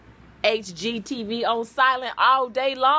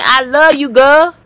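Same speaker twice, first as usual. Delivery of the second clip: a hint of a loud, gruff dog voice; dog's voice; 2 semitones higher, 10 dB louder than usual. Voicing the dog declaring love to her. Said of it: "He said".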